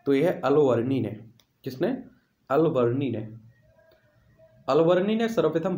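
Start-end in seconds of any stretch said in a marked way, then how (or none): none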